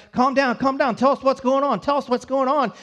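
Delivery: urging someone to calm down and talk; urgent calming. Retold or imitated. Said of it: "And they're like".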